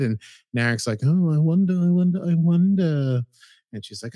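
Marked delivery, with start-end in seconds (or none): 1.0-3.2 high, loud sing-song wondering; sly mock musing. Narek slyly musing over Soji's fears, high sing-song mock. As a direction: ham up a scheming "I wonder".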